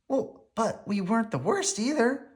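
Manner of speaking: little sister putting a good face on it; Sister's voice. A narrator's reading. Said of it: "said Sister".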